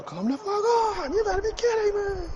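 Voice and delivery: high-pitched mock exasperation; imitated outburst. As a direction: mimic a gamer losing it, without real volume. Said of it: "as soon as one thing goes bad".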